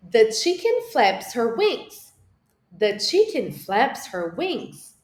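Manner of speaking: slow model sentence. Shown as a demonstration. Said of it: "Agora vamos falar inglês?".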